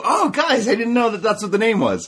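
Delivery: high, gushing mock apology; mock sheepish server. Server admitting the drink name, mock sheepish.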